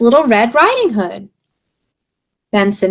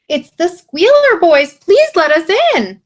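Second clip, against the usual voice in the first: squealing pig voices; 9 semitones higher, 3 dB louder than usual. Acted. Pigs voiced at the door.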